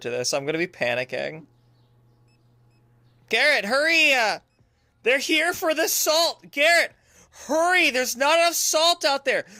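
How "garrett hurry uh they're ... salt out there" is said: high, loud frantic calls; urgent yelling. Pretend. Boss urges Garrett to restock salt, urgent yelling. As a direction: holler at a helper to rush. Urging helper to restock fast.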